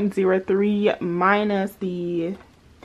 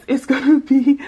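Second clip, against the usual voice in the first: laughing; 5 semitones higher, 7 dB louder than usual. Real, not acted. Laughs mid-sentence.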